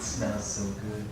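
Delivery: low, slow and soft, savoring the smell; hushed mouse talking to himself; quiet, tempted murmur. Acted. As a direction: think it aloud, softly and slowly. Little mouse tempted by the soup.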